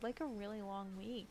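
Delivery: ordinary talk, nothing marked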